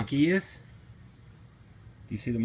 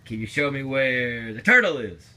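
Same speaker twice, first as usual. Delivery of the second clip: usual speaking voice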